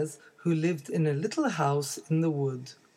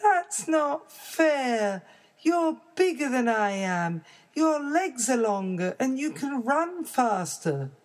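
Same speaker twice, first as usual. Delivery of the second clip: put-on whiny baby bear; 8 semitones higher than usual. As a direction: protest like a small child.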